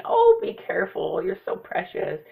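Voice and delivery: lightly voiced doting grandparent; a hint of a fretting grandma; fussing grandparent. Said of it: "the cliche parent thing or grandparent thing".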